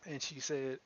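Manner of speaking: ordinary talk, nothing marked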